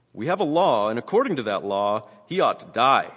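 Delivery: loud, declaimed demand; forceful accusation. A narrator's reading. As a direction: proclaim it as the insistent accusers. Acted quote, crowd demands death.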